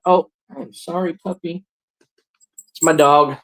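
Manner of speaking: ordinary delivery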